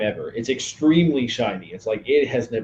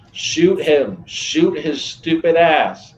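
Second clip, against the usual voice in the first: raised, higher-pitched yell; slow, punchy in-character yell; yelled down the road; 6 semitones higher, 5 dB louder, much slower than usual. Acted. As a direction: call it out loudly to someone down the road. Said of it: "I also yelled down the road".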